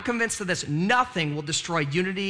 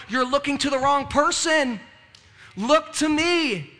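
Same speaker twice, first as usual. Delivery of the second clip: God's voice; 7 semitones higher, 4 dB louder, slower than usual. Retold or imitated. God answers the complainer.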